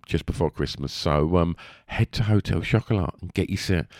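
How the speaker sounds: usual speaking voice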